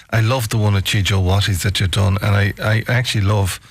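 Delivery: normal talking voice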